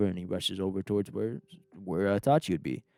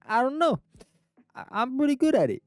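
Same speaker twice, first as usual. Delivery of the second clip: put-on Italian accent; 6 dB louder than usual. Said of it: "us Italians".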